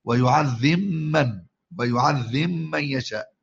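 ويعذب من ببطء بميم مشددة بدل الباء; قل ويعذمّن يشاء بإدغام الباء في الميم; إدغام الباء في الميم. Shown as a demonstration. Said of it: "يدغم الباء في الميم". (قراءة بطيئة لويعذب من بالإدغام)

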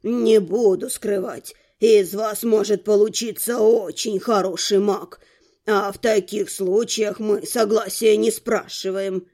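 put-on low, measured old man's voice; old rector. A narrator's reading. Rector explains how mages are recruited, measured old man. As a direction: explain it slowly in a deep register.

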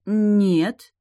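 drawn-out surprised no. A narrator's reading. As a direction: stretch it out in surprise. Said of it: "удивлённо протянула я".

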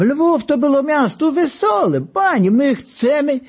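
put-on high, slow old Lwów man insisting; old Lwów man calling. Old man with a beet nose demands cheerful songs, high and slow. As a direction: raise the pitch and drag it out like a tipsy old man. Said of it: "zawołał ten z buraczanym nosem".

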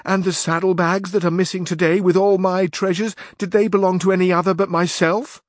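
high, loud aggrieved protest; indignant squire. A narrator's reading. Squire protesting to his knight about his own losses, high loud indignation. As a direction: complain that the losses are yours. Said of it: "replied Sancho".

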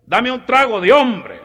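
high, loud, slow swagger; macho order. Retold or imitated. Rigo ordering a man's drink from the bartender, high, loud and slow. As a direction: demand it slowly like a macho cowboy.

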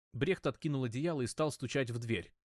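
plain reading voice

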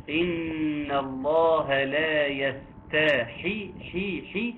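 يستحي chanted with the ha wrongly voweled; يستحي with a clear obvious mistake on the ha. Shown as a demonstration. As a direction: demonstrate the error on حي, slowly and sung. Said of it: "خطأ، لحن جلي".

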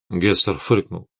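ordinary delivery

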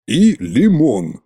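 slow, mildly chiding; reproachful. A narrator's reading. King looks at the butler's tray, mild reproach. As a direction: draw it out as a gentle reproach. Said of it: "укоризненно покачал головой царь-батюшка".

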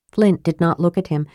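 plain reading voice

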